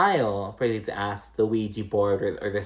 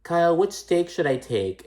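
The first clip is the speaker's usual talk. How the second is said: higher, sweet mock question; imitating Kathy; 8 semitones higher than usual. Kathy asking Kyle about steak, high innocent mock voice. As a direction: ask innocently in a raised voice. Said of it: "she was like".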